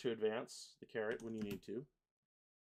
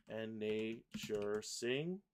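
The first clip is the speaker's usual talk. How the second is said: very slow, stretched syllables; syllable-by-syllable reading; much slower than usual. Shown as a demonstration. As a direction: draw out each syllable while placing it. Reads lyrics while pasting syllables.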